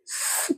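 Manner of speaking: स as a long pure hiss 'sss', no vowel; letter sound. Shown as a demonstration. Teacher shows pupils the sound of S, a held hiss. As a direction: sustain स as a bare s sound. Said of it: "इस तरह से इसको".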